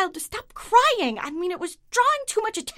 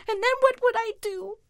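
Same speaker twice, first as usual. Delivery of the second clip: tearful whine; 5 semitones higher than usual. Acted. Tearful comic rant.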